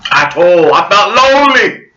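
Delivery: hammed-up anguished cry; mock wail. Vlogger hams up loneliness in a high, loud wail.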